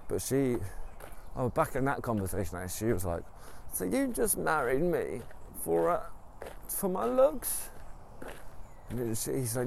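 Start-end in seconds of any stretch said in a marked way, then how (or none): 3.7-7.7 wife's voice. Retold or imitated. Wife asks husband about her looks, high hurt voice. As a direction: play the hurt wife, pitched way up.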